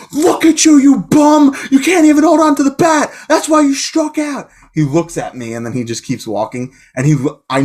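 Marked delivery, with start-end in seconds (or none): 0.1-4.5 high, loud mocking heckle; heckling yell. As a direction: taunt him from the stands. Heckling a batter who dropped the bat.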